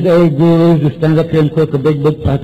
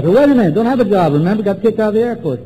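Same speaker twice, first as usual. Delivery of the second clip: higher-pitched, hurried objection; protesting; 6 semitones higher, faster than usual. Retold or imitated. Newcomer objecting to sponsor's gratitude list, protesting. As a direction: break in with an indignant retort.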